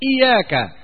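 "إياك" said iyāka, ya not doubled; light ya, no shadda. Shown as a demonstration. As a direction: keep the ya of "إياك" single. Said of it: "بالتخفيف بدون تشديد". ("إياك" بالتخفيف)

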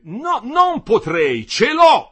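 very loud, high, slow outburst; furious interruption. A narrator's reading. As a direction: cut him off in a rage. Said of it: "lo interruppe Badolati".

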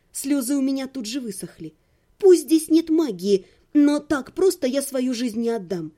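ordinary delivery

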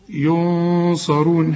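ينصرون slow, with a thick, heavy ص; heavy sad. Shown as a demonstration. Teacher models the Quran word for the student. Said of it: "هنفخمها علشان الصاد".

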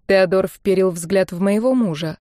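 ordinary delivery

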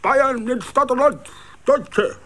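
high, loud ranting orator; hammed-up dictator speech, shouted high; mock Hitler ranting. A narrator's reading. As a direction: rant like a carnival Hitler. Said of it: "schrie schier gottesmächtig".

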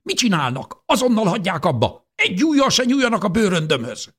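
put-on old woman screaming to guard her suitcase; furious outcry. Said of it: "dühösen felkiáltott".

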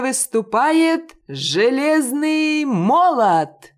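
high, loud, slow announcer call; ring announcer. A narrator's reading. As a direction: stretch it out like a boxing ring announcer. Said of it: "вещал дальше диктор". (голос ринг-анонсера, громко и протяжно)

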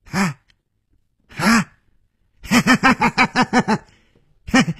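high staged villain laugh in bursts; wild laughter. A narrator's reading. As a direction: start with separate cries, then cackle high. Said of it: "他放声大笑".

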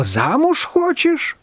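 very high, slow astonished question; surprised. A narrator's reading. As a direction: drag it out in disbelief. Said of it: "удивилась Ляля". (Ляля удивлённо тянет вопрос очень высоко)

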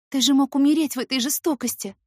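high loud anguished reproach; anguished cry. Acted.

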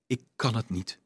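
a very soft, broken murmur; crushed murmur. A narrator's reading. Johannes confessing to Dolf, crushed murmur. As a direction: barely get the words out, defeated. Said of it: "prevelde de man verpletterd".

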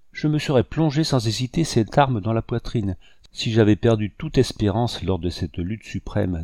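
usual speaking voice

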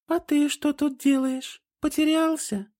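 very high, tender coaxing; gentle. A narrator's reading. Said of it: "ласково спросила Триан".

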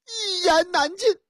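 high, weary female voice; high world-weary woman; sighing woman caller. Acted. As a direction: sigh it out like a woman.

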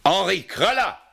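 loud, urgent name call. Acted. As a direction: shout the name down the line. Calling name into telephone.

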